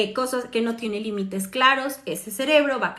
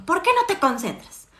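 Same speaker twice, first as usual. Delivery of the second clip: exasperated mom; 6 semitones higher, 4 dB louder than usual. Retold or imitated. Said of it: "le digo a mi hijo".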